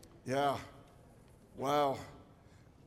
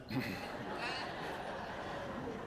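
breathy laugh; 7 semitones higher, 14 dB quieter than usual. Real, not acted. Laughs at the doctor's news.

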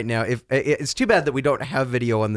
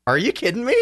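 surprised; 11 semitones higher than usual. Retold or imitated. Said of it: "I said".

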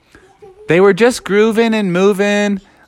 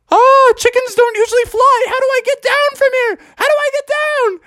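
much higher worried chicken voice; panicked chicken. Pretend. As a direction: squeak it out high, stuck in the air.

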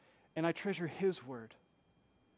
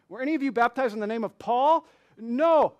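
higher and louder rhetorical question; emphatic quote; 5 semitones higher, 10 dB louder than usual. Retold or imitated. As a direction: ask it loud, then slam the no. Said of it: "big asterisk to that last implied no question".

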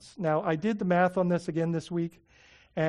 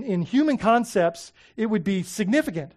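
ordinary talk

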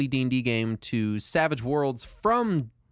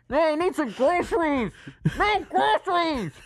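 high squeaky pleading voice, slowing on the last line; mock high voice. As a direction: play a silly panicked shopper. Acting out a robbery skit.

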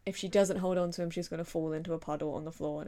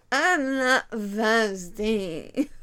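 put-on loud, very slow drunken slur; drunk Emily; 9 dB louder, much slower than usual. Emily refusing water from her friends, drunkenly loud. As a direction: drag every word out, loudly and sloppily. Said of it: "they immediately realise that she's drunk".

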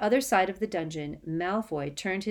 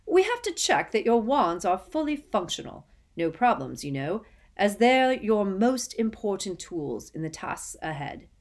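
much louder, booming welcome; hearty jovial host; 4 semitones higher, 5 dB louder than usual. Acted. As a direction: welcome him like a jolly host. Bagman explains jovially.

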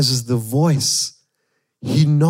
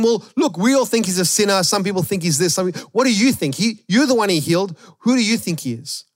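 higher, pushy voice of the Pharisees; mock questioning Pharisees; 7 semitones higher than usual. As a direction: play the prying religious leaders, pitched up. Pharisees questioning the healed man.

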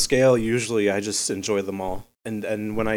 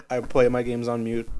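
usual speaking voice

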